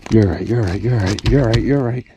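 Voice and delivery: loud, rapid repeated words, real excitement; excited repetition. Real, not acted. Angler holding the big bass, excited repeating. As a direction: gush it over and over.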